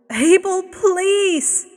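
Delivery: high, loud desperate cry of a name. Acted. Woman cries out to Abel, high and loud. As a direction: she cries out to stop him. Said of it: "cried the woman".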